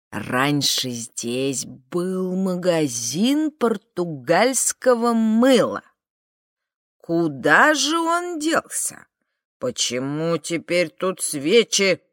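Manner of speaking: put-on slow, drawling old man, every word stretched; drawn-out words. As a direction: stretch each word out, beaming yet disappointed. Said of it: "растягивая каждое слово интонациями из фильмов Киры Муратовой".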